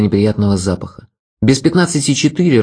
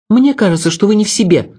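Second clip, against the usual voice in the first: woman's retort, pitched much higher; snappish retort; 9 semitones higher than usual. A narrator's reading. Mary talks back to the man scolding her, snappish. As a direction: snap back in a high voice. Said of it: "огрызнулась она".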